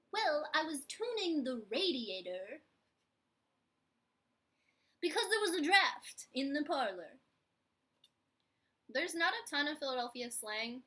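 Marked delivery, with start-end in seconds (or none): 0.1-7.2 put-on 1 Philly accent. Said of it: "so then I'm like".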